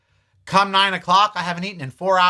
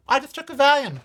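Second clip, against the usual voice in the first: Valium tossed up much higher, pitched up in disbelief at himself; incredulous high emphasis; 10 semitones higher, faster than usual. Real, not acted. Vlogger recalling his old pill habit, pitched up in disbelief. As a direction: marvel at how casually he popped pills.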